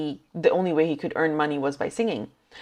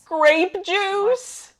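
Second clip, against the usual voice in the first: high, puzzled rising question; surprised question. Real, not acted. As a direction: ask it high in disbelief. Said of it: "it's grape juice".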